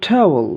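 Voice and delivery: "ট্রাভেল" opening with a puffed t; breath burst on the t of "ট্রাভেল"; aspirated T. Shown as a demonstration. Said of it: "যেমন". ("ট্রাভেল" মহাপ্রাণ ঠ দিয়ে শুরু)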